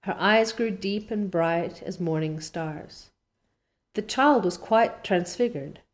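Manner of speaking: ordinary delivery, nothing marked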